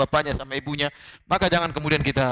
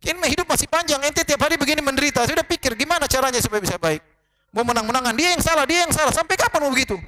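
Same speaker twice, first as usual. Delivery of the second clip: much higher, pleading scold at a quarreling couple; exasperated scolding; 8 semitones higher than usual. Retold or imitated. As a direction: scold both of them, fed up and pleading. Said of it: "bolak-balik saya bilang". (teguran jengkel bernada tinggi)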